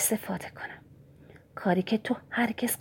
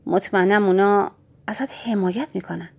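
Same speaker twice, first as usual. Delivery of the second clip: usual speaking voice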